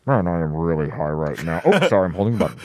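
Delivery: low, lazy stoned pilot voice; stoner. Pretend. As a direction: sound baked on the intercom. Stoned pilot joke on intercom.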